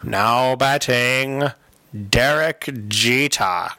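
slow, drawn-out ballpark announcer; stadium announcer. Pretend. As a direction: stretch the name like a PA announcer. Repeats announcer bit.

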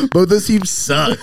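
high, slow, dismissive sneer; mocking quote. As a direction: scoff the line out, mocking. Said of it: "I was like".

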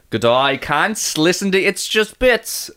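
slow, stretched Aussie greeting; confident put-on Aussie; Australian accent. Shown as a demonstration. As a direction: demonstrate a broad Australian accent. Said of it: "I think I got this one actually".

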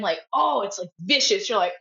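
normal talking voice

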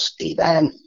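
high, full-voiced call across the house; retold holler of a name across the house. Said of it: "hollers at the top of her lungs".

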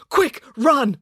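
high-pitched urgent order; urgent alarm. A narrator's reading. Dad hurries children from the collective, urgently alarmed. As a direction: get them moving at once.

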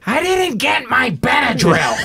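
high, slow, shouty whine; Gilbert Gottfried impression. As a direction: squawk it like a cranky comic.

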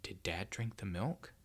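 slow, soft near-whisper; hushed, drawn-out suspicion; hushed question. A narrator's reading. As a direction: ask it quietly, frozen on the spot. Boy freezes, asks about the milk.

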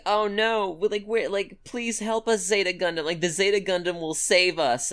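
high, whiny cry for help; mock panicked plea. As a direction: ham up helpless bystanders begging. Mocking panicked civilians.

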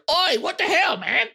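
high, indignant shopkeeper gnome yelp; annoyed gnome. Acted. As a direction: squawk at the runner like a startled gnome. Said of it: "You hear a gnome go".

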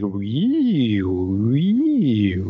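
swooping radio tuning whistle; radio frequency whine. Acted. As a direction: imitate a radio dial sweeping up and down. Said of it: "like, a radio frequency".

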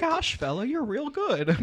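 high, soft, put-on gee-whiz voice; mock impressed recruiter. Friend parodying clan kid praising the player, high mock wonder.

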